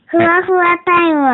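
big loud voice. Real, not acted. Child announces the segment on dad's request, in a big voice. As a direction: belt out the title for everyone to hear. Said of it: "大きな声で".

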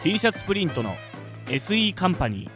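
measured announcer delivery; sponsor read. Shown as a demonstration. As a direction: read out sponsors in neat, separate phrases. Reads sponsor credits. (提供読みのアナウンス口調)

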